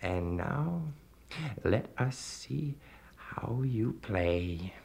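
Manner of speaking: slow, sinister whisper. A narrator's reading. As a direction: breathe it out like a temptation. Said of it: "said the stranger".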